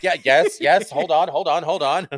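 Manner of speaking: scramble, breathless and eager, fumbling for the phone; flustered excitement. Retold or imitated. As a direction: fan fumbling for the phone, loud, high and frantic. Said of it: "stumbling, grabbing my phone".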